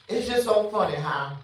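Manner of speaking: normal talking voice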